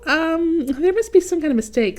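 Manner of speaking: lightly voiced indignant ornament; puzzled Otto. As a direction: object quickly, a bit put out. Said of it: "said Otto, scratching his cap".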